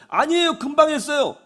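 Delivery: put-on high whiny child insisting; child protesting. Child insists to his mother, high whiny voice. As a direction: protest like a sulky kid.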